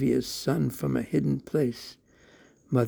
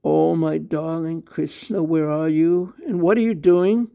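calling out, rising higher and louder; 4 semitones higher, 6 dB louder than usual. A narrator's reading. Mother calling loudly for her son Krishna. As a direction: call out to find the hiding child. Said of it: "she called out".

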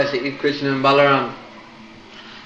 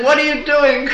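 panicked squeal; 13 semitones higher, 5 dB louder than usual. Acted. Shrinking demon panics.

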